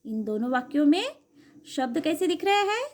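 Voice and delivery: ordinary talk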